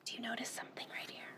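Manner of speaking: fast unvoiced whisper. Reader whispering to the children about the picture. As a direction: hush the kids and point it out quickly.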